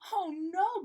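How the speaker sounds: high, loud dismay; gasped alarm. A narrator's reading. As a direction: gasp out in horror. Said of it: "gasped Gilbert".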